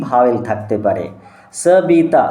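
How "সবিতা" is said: সবিতা as three distinct beats, স-বি-তা; split into syllables. Shown as a demonstration. Teacher counting three syllables aloud, সবিতা broken as স, বি, তা. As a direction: sound out সবিতা in pieces. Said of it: "তিনটে syllable".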